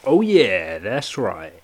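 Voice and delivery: big drawn-out mock-cool sign-off; playful echo. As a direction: mimic the caller's catchphrase with gusto. Echoing the caller's sign-off.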